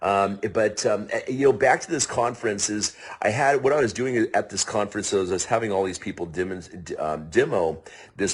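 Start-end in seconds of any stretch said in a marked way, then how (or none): none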